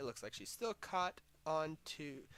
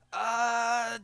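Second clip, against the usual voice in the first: loud yell; 8 semitones higher, 14 dB louder than usual. Acted. Bellowing before shouted choice.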